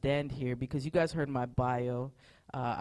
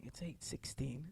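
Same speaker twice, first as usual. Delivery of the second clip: much softer, slow groggy murmur; sleepy murmur; 13 dB quieter, slower than usual. Retold or imitated. Phone rings again, pastor murmurs the time sleepily. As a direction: drag the words out half awake. Half-asleep, thinking in bed.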